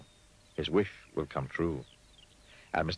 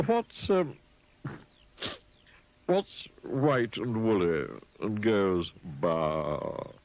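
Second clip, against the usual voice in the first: straight-faced pig; 4 semitones higher, 3 dB louder, much slower than usual. A narrator's reading. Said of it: "trying to keep a straight face".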